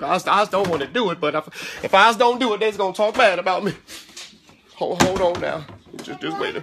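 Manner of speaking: hammed-up Southern servant, flustered and urgent; harried subservient servant. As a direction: fret aloud while answering the boss. Harried servant answers and complains.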